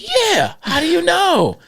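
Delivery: voicing the friend's stunned surprise; mock-astonished yeah, how do you know; amazed friend.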